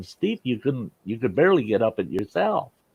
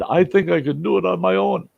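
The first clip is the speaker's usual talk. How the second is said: imitating the big patient; 10 semitones higher than usual. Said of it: "He said".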